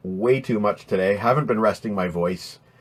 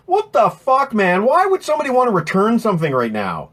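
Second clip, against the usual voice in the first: outraged rant; 10 semitones higher, 3 dB louder than usual. Real, not acted. Outraged rant about returns.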